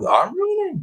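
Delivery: indignant disbelief; I'm ruining said in shocked disbelief; incredulous. Real, not acted. Incredulous at chat accusation.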